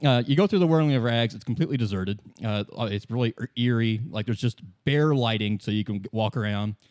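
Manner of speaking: normal talking voice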